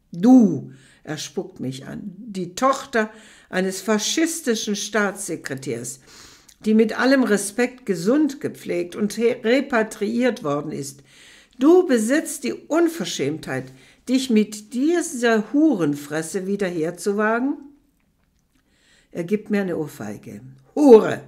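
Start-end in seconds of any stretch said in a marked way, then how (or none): 0.1-0.7 explosive loud shout of rage; enraged commander screaming at the woman; furious scream. Acted. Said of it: "mit unvorstellbarer Wut losschreit". 2.2-17.6 loud raging tirade; furious shouting. Acted. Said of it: "mit unvorstellbarer Wut losschreit".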